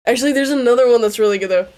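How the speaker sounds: fast and loud, bubbling over; excited. Real, not acted. Vlogger to viewers, gushing about a banger song, excited. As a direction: gush about the next song. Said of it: "I got a little excited".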